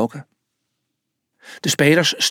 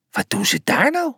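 angry call; 12 semitones higher than usual. A narrator's reading. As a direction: exclaim crossly, rising high. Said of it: "riep hij kwaad".

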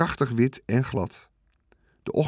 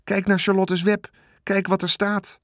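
put-on high, eager child's call; 8 semitones higher than usual. A narrator's reading. Veerle urging everyone to read the new word, excited. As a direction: point it out with bright excitement. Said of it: "riep Veerle".

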